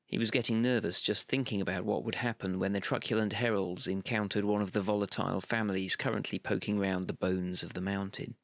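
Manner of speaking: ordinary delivery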